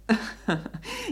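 bright high little laugh; high giggle. Acted. Lady teasing her maid Anna with a high giggle.

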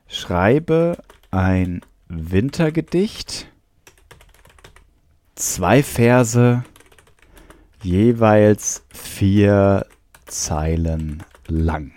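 very slow, halting dictation with long pauses; drawn-out words, paced to typing; dictating while typing. Shown as a demonstration. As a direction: spell out a typed command piece by piece.

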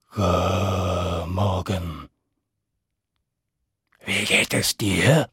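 low, slow, sly dragon mother. Acted. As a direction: drag it out low and suspicious. Dragon mother asks slyly.

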